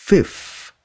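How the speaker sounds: "Fifth" with a puff of air and the th; 1 breathy fifth. Shown as a demonstration. Teacher shows the th breath in fifth. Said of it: "phì ra một cái, xong rồi T H".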